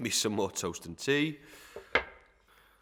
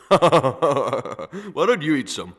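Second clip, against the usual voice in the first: laughing; 4 semitones higher, 9 dB louder, much slower than usual. Acted. Master mocks Giles' appetite.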